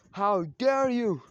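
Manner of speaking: loud, high, drawn-out indignation; outraged challenge. Acted. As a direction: hurl it out as a defiant attacker. Defiant ninja acted out attacking.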